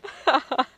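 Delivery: loud squealing laugh burst; high shrieking laugh. Real, not acted. Drunk streamer cracking up.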